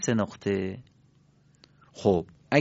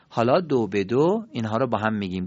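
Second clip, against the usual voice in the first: ordinary delivery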